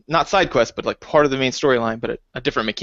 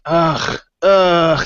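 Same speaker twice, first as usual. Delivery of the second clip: drawn-out disgusted groan, growing louder; two exasperated ughs, the second louder; frustrated groan; 4 semitones higher, 4 dB louder than usual. Retold or imitated. Player reacting to restarting the maze, frustrated groan.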